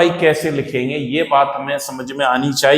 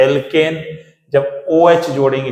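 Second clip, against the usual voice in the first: ordinary talk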